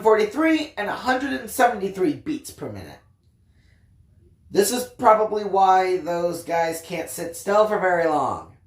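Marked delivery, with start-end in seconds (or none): none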